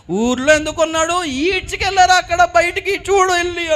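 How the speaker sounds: high-pitched, urgent outcry; acted high, sustained exclaiming; agitated cry. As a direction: cry out the terrible news. Townsperson crying news to the mother.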